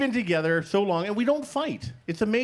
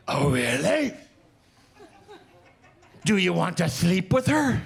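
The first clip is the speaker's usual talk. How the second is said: sharp, louder jealous challenge; icy suspicion from a jealous wife; 2 semitones higher, 3 dB louder than usual. Retold or imitated. Jealous wife confronting husband.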